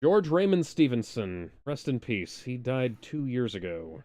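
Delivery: ordinary talk, nothing marked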